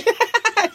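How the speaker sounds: high burst of laughter. Real, not acted. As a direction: crack up laughing. Laughter at the mocking.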